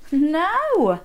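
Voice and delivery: ordinary delivery, nothing marked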